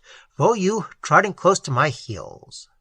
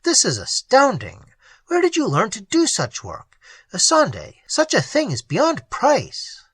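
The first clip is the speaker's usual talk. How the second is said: higher, amazed praise; awestruck, pitched up in wonder; astonished exclaim; 8 semitones higher than usual. A narrator's reading. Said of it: "I exclaimed".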